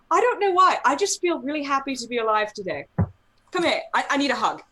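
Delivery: high, loud, overjoyed gushing; giddy drunk friend. Retold or imitated. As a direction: gush like a happy tipsy friend.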